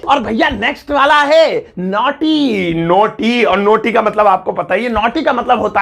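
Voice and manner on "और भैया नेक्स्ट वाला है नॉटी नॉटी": high, slow showman announcing; game-show style reveal; announcer intro. Acted. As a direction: announce the next word like a host. Recurring segment announcer intro.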